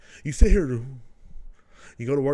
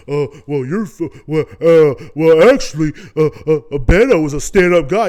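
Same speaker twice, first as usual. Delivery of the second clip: loud stammering mock liberal voice; mocking a flustered liberal; 14 dB louder than usual. Mocking liberal friends who defend Beto, loud stammering. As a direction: sputter and stall like a cornered debater.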